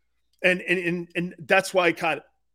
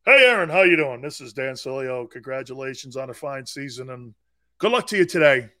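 mock-cordial greeting, loud opener then low murmur; mocking politeness; 6 semitones lower, 5 dB louder than usual. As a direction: parody an overly nice player greeting his opponent. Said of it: "this is what some of you guys today".

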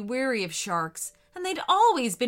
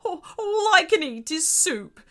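high, loud, hammed-up sobbing; crying; 7 semitones higher, 6 dB louder than usual.

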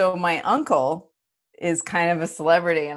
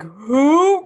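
high, loud, drawn-out mock puzzlement; baffled who; 10 semitones higher, 8 dB louder than usual. Podcaster imitating people who don't recognise the name, baffled. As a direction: blurt it out as utterly clueless.

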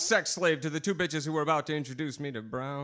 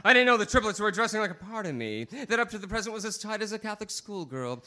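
ordinary talk, nothing marked

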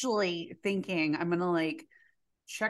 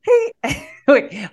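ordinary delivery

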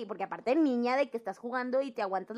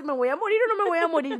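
higher, louder, fast frantic question; panicked child; 6 semitones higher, 8 dB louder, faster than usual. Retold or imitated. Girl to her crying father, mock panic. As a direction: demand the truth like a scared kid.

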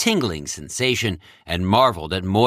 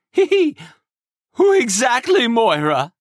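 high, nervous pressing; squeaky, tense nervous voice; shaky voice; 13 semitones higher, 4 dB louder than usual. Acted. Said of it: "voice shaky".

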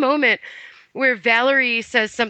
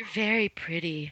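mock creepy guy; 4 semitones lower, 10 dB quieter than usual. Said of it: "that standard creepy guy stuff".

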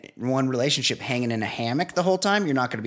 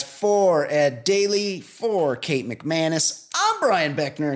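podcast announcer sign-off; 4 semitones higher than usual. Acted. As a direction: stretch out each name like a show announcer. Reads the show sign-off.

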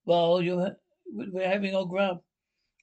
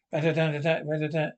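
mock machine-gun rattle; imitated gunfire; 2 semitones lower than usual. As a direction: rattle off a spoken burst of gunfire.

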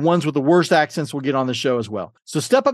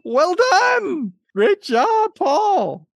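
much higher, put-on cheering voice; high-pitched congratulation; 13 semitones higher, much slower than usual. Host to contestant who answered correctly, high put-on voice.

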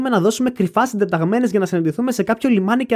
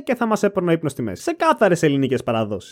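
usual speaking voice

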